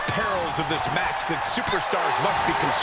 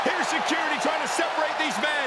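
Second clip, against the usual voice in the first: high, excited brawl call; wrestling commentator; 7 semitones higher than usual. Real, not acted. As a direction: call the brawl live, pitched up. Live brawl play-by-play.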